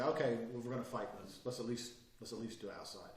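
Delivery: low, soft, reasonable; calm acceptance. Acted. Man standing up to his attacker, calm. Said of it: "It's the change in body language".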